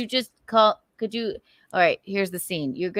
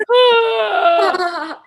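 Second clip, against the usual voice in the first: high, loud laughing "Ah"; mock swoon scream; 10 semitones higher, 7 dB louder than usual. Woman imitates friend's reaction, loud high mock scream. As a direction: let out a high overexcited cry.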